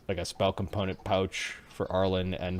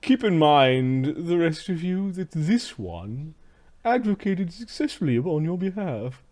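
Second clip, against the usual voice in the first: haughty slave master; 6 semitones higher, slower than usual. Acted.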